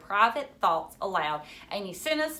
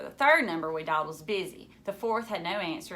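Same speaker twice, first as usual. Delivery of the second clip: ordinary delivery, nothing marked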